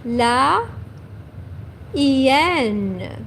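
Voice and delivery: "hyène" drawn out, ee-YEN; slow model word for repeating; French la hyène. Shown as a demonstration. Teacher models the word for students to repeat, slowly. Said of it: "Répétez après moi".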